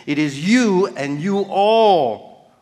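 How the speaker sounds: "you" and "you all" drawn out slowly; stress on YOU ALL. Shown as a demonstration. Preacher explains the plural to the congregation, slow stress on "you all". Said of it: "it is in the plural".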